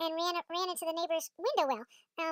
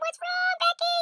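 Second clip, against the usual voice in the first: high, raised alarmed call; dad yelling; 11 semitones higher, 4 dB louder, slower than usual. Retold or imitated. Dad yelling from the phone in a pocket, high alarmed shout. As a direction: imitate a worried yell from afar. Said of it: "my dad's yelling. He's yelling from my pocket".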